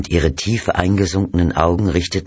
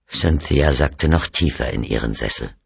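usual speaking voice

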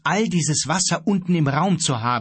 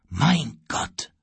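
leicht gestöhnter Ausruf; stöhnend. A narrator's reading. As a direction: mit einem Anflug von Stöhnen ausrufen. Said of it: "stöhnte Kapitän Davenport". (verhaltenes, entsetztes Stöhnen)

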